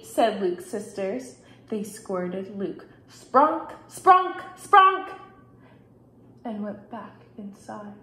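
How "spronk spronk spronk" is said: three loud, high, separate bursts; squirt gun noises. A narrator's reading. Sisters squirting Luke, punchy sound effects. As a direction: fire each word like a squirt, climbing.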